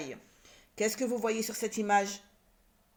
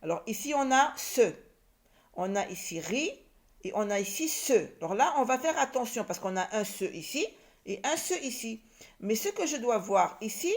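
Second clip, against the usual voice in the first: usual speaking voice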